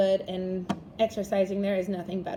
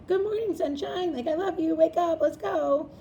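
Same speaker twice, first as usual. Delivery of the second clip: higher, sing-song loving wake-up call; sweet parent; 6 semitones higher than usual. Retold or imitated. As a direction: coax a sleepy kid awake with affection. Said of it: "If they're like".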